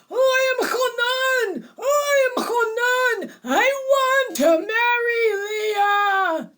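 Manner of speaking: put-on high, loud shout in a Klopski accent; throaty accented shout. A narrator's reading. Possessed bride declares who is inside her, shouting throatily. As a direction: shout it out throatily, stretching the end. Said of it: "shouted in that throaty voice with that Klopski accent".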